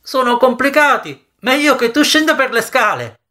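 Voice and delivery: high-pitched calling voice; Jory calling out. A narrator's reading. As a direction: call advice after him, pitched high. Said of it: "gridò ancora Jory". (voce acuta che chiama da lontano)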